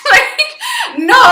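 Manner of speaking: high laughing no. Real, not acted. Vlogger laughing at her own blunt refusal, high laughing no. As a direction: laugh it off, squealing no.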